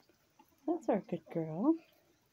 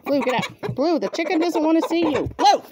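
loud, raised-pitch command; scolding a dog; 6 semitones higher, 10 dB louder than usual. Real, not acted. Shooing Blue away from the chickens, loud scolding. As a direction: order the dog out, loudly.

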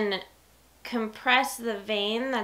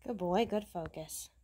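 soft praise; 3 semitones lower, 9 dB quieter than usual. Real, not acted. Soft praise while fainting.

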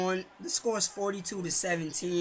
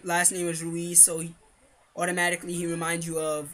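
normal talking voice

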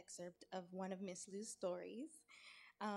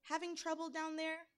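higher-pitched mock-concerned question; teasing; 8 semitones higher, 3 dB louder than usual. A narrator's reading. Anansi peers down at trapped Tiger, teasing. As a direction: needle him with a singsong question.

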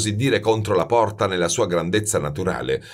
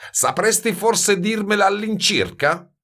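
higher, goading question; mocking challenge; 13 semitones higher than usual. A narrator's reading. Baldini goads silent Grenouille for the formula, mocking. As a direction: taunt him with a rising question. Master taunts silent youth.

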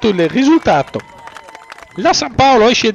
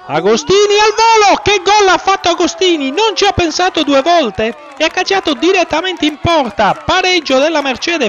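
excited goal call; 7 semitones higher than usual. Real, not acted. Equaliser goal, live commentary.

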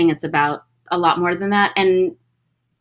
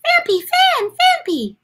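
very high squeaky creature cry; Phanpy voice; slower than usual. Acted. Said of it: "what he sounded like".

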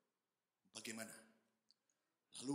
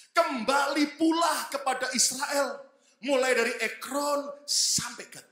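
slow, loud, high preaching read; preaching scripture; 8 semitones higher, 5 dB louder, slower than usual. Acted. Preacher reading the Samuel verse to the congregation, slow loud proclamation. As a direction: proclaim the verse slowly to the hall.

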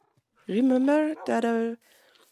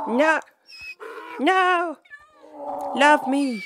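high, loud protests; playful insistent pleading; 7 semitones higher, 4 dB louder, much slower than usual. Pretend. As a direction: plead loudly with a stubborn animal. Begging donkey to accept her.

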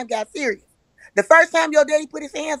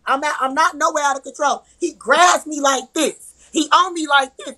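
ordinary talk, nothing marked

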